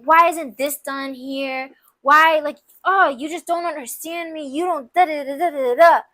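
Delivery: exasperated rant, hammed up, dragging the first words; super angry. Partner takes a bad day out on you, angry. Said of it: "just super angry".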